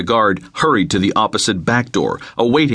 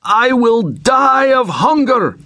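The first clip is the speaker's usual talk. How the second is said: high, slow, desperate screaming; maniacal scream; 14 semitones higher, 3 dB louder, slower than usual. Acted. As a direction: scream it like a starving madman. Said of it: "finally screaming maniacally for hours".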